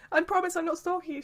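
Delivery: high-pitched, jokey imitation; joking acquaintance. Lady bumping into them at the hotel bar, imitated jokey protest. Voices lady, laughing afterwards.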